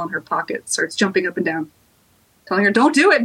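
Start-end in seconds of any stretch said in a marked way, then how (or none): 2.7-3.2 high, urgent little plea; urgent warning. Acted. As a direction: warn her fast, pitched up. Doll jumping, warning her.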